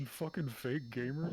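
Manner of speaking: fake-angry insult to a friend; mock outrage. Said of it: "I'm just bullying you".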